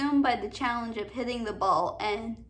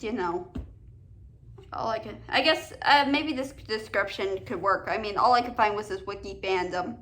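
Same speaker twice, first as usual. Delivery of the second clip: normal talking voice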